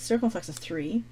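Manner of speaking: ordinary talk, nothing marked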